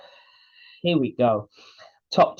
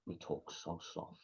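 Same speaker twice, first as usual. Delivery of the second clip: talking so soft; 4 semitones lower, 22 dB quieter than usual. Shown as a demonstration. Host imitating Jake's hushed promo voice. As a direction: voice dropped right down to a whisper. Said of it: "Jake just brings his voice right down".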